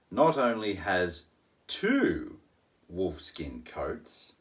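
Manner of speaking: ordinary delivery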